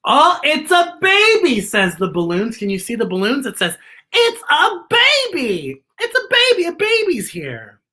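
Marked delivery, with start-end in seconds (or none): 0.0-1.6 much higher and louder, thrilled; squealing with joy at the news; excited announcement. Acted. Balloons announcing the new baby. 4.1-7.8 put-on very high, delighted, slowing on the first "baby"; joyful squeal welcoming the baby; excited cheering. Balloons announcing the new baby.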